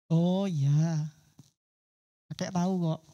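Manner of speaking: put-on knowing old man, unbothered; calm grandfather. Grandfather calmly reveals he knew. (kakek bersuara rendah dan tenang)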